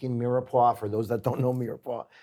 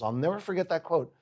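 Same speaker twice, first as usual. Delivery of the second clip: ordinary talk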